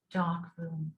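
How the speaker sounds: ordinary talk, nothing marked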